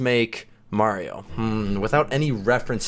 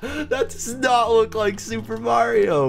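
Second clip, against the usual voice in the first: laughing disbelief; 10 semitones higher than usual. Real, not acted. Laughing at the ugly build.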